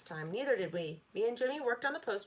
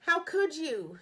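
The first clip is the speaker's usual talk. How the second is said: higher, louder indignant; boy scolding classmate, voice raised; indignant reproach; 7 semitones higher, 6 dB louder, much slower than usual. A narrator's reading. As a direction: call her out. Reproaching Sheila.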